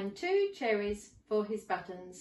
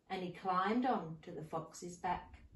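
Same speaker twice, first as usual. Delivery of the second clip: plain reading voice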